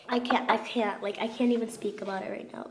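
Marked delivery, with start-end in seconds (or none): none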